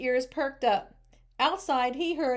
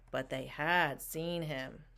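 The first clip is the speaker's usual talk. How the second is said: ordinary delivery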